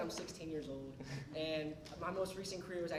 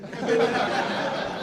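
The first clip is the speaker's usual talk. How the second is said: breathy laugh; 3 semitones higher, 14 dB louder than usual. Real, not acted. Laughs at cougar joke.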